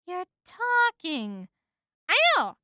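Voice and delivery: high, emphatic disbelief; astonished exchange. A narrator's reading. Woman discovers a bee can talk, astonished. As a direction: pitch up in amazement.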